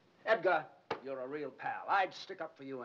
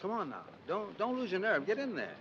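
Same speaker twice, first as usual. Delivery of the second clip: urging on; 7 semitones higher than usual. Acted. Urges nervous man to go in.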